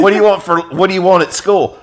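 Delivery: high, loud cartoonish question; mock parent. Parent asks the kid about breakfast, high singsong.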